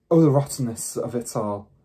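plain reading voice

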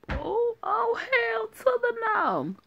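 very high, slow "hell to the no"; over-the-top sassy refusal; sassy outrage. Acted. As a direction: squeal it like a scandalised diva.

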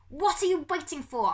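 high, loud impatient howl. Acted. Humphrey urging Pickering to fire, howling. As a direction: howl it at him. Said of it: "Humphrey howled".

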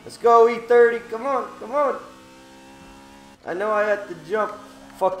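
loud and high, pumped; hyped up. Real, not acted. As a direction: cheer the car on. Hyped during race start.

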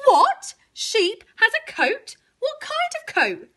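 high indignant questions; high astonished cow voice; shocked cow. A narrator's reading. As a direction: squeal it as a jealous cow. Cow reacts with jealous shock.

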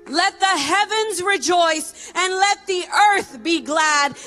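usual speaking voice

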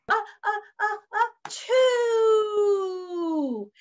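acted sneeze. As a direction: imitate a big drawn-out achoo. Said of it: "The bear was about to sneeze".

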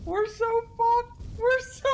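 laughing despair. Real, not acted. Disaster, laughing panic.

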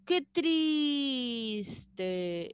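lamenting exclamation with "triste" drawn out. Shown as a demonstration. Teacher demonstrating exclamatory sentences, drawn-out exclamation. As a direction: stretch "triste" in a long falling cry. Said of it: "las oraciones exclamativas".

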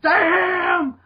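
hammed-up high yell; yelled Damn. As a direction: echo the high, drawn-out mock yell. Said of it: "and then yell".

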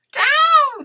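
high, loud command; loud cry. Acted. Witch orders descent after losing wand, loud cry. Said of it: "cried the witch".

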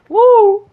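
sudden very high loud woo; squealing celebratory hoot; weird whoop. Real, not acted. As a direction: let out a strange high-pitched whoop. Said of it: "make it a weird sound for".

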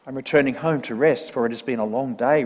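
usual speaking voice

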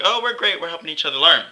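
high, sing-out imitation of happy teammates; lightly mocking, high-pitched quote; mock cheery. Mimics the contented team.

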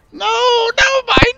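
very high, loud wailing cry over the tour bus; squeaky creature sobbing in despair; panicked high creature cry. Acted. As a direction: shriek it out like a tiny distraught musician. GM voices panicked band member.

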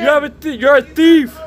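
real shout at the fleeing thief; angry accusing shout. Real, not acted. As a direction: hurl the accusation at him. Shouting accusation over crowd.

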